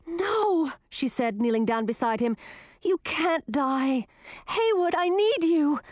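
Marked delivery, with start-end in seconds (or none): 0.1-0.7 a high, stricken cry of no; the word breaks out in grief; anguished no. A narrator's reading. Grisina over dying Haywood, anguished. 2.8-5.8 desperate plea. A narrator's reading. Grisina begging dying Haywood, desperate plea.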